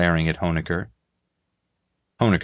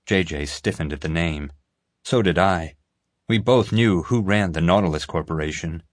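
ordinary delivery, nothing marked

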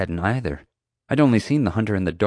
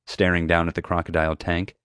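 usual speaking voice

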